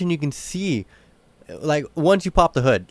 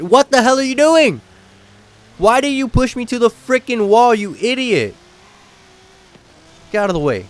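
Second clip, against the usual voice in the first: loud, higher furious yell at another driver; angry shout; 6 semitones higher, 5 dB louder than usual. Real, not acted. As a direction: blow up at the car that shoved you. Rammed by another racer.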